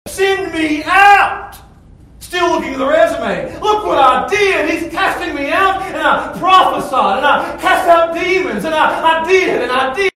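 loud, high indignant shouting; angry protest. Acted. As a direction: rail at God for the injustice. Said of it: "You get, you're angry".

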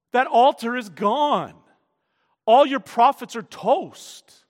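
full-force preaching. Acted. Preacher confronting Ahab with the evidence, fervent and loud. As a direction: thunder the proof home.

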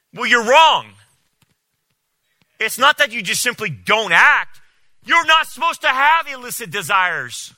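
preacher rebuking the pastor, angry and loud; angry rebuke. Real, not acted. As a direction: shout him down.

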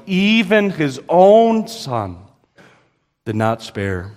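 italicize "even" with the voice; stress on EVEN. Shown as a demonstration. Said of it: "you would italicize that expression".

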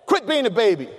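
a hint of a taunting older brother; mocking big brother. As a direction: sneer it like a bossy big brother. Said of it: "my brother said".

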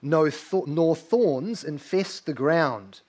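slow, measured hymn recitation; recited hymn. Acted. Pastor reciting the carol's words slowly to the congregation. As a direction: intone each line like a sung verse.

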